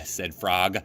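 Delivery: plain reading voice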